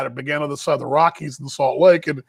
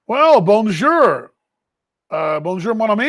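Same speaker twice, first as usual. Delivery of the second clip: tentative French greeting; 3 semitones higher, 3 dB louder than usual. Real, not acted. Host greets a listener watching from France in French. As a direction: try out your schoolbook French. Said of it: "Is that how you say it?".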